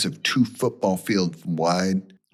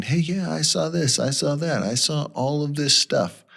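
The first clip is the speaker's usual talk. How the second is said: excited, higher-pitched mock callers; 7 semitones higher than usual. Radio listeners phoning in about sightings, excited. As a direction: pile up the claims like eager callers. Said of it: "people are calling into the radio station like crazy".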